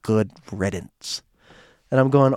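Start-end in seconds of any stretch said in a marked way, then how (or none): 0.0-1.2 slow, near-whispered aside; retold dismissal. Joanne dismisses her son, quietly contemptuous.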